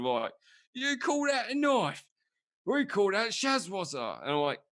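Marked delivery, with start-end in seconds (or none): 0.7-4.2 high, slow cartoon British voice; mock British accent. Mocking how cartoons do Australians, high exaggerated British-sounding accent. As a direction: squawk it slowly in a bad British accent. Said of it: "it's like this British accent".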